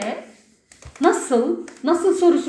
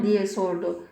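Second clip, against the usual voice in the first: usual speaking voice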